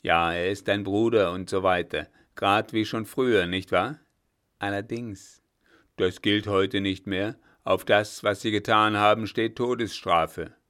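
put-on loud stern old judge; stern threat. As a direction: lay down a death sentence loudly.

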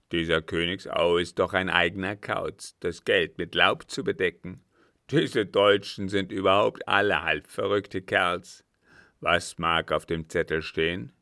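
loud, scornful captain's voice. A narrator's reading. Captain scorning Königsau to the count, forceful. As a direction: scoff loudly at the oddball.

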